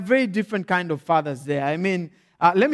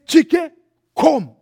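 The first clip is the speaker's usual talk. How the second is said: high, loud summons; bold and emphatic; 6 semitones higher, 6 dB louder than usual. Acted. Nigerian father summons his son, bold and emphatic. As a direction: call out short and commanding. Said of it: "they're very bold and emphatic".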